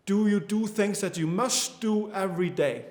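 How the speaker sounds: ordinary talk, nothing marked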